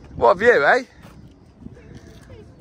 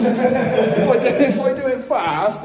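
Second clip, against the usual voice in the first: nervous laughing; 7 semitones higher, 4 dB louder, slower than usual. Real, not acted.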